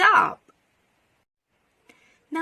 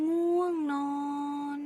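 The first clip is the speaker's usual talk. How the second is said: higher, softer, drawn-out pretend sleepiness; mock sleepy; 4 semitones higher, 5 dB quieter than usual. Teacher hints the word's meaning to children, mock sleepy. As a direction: stretch it out as if drowsy.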